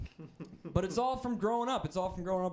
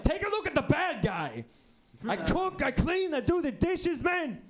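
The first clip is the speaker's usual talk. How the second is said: Scarface mom; 10 semitones higher than usual. Retold or imitated. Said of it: "She was like Scarface".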